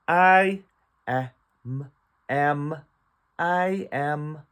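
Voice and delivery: "I", then "am" split and blended, then "I am"; phonics sounding out. Shown as a demonstration. Sounding out the cat's words.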